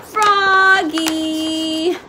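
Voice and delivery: a dad calling out over spilled coffee; exasperated father yell. A narrator's reading. Father yelling at Froggy after the coffee spills, exasperated. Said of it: "yelled his father".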